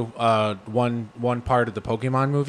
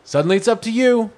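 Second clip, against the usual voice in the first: ordinary delivery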